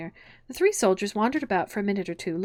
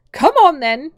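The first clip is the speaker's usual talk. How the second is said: high, very loud command; roared; 7 semitones higher, 15 dB louder, slower than usual. A narrator's reading. Queen summoning Alice to croquet, roared. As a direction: roar it like an impatient monarch. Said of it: "roared the Queen".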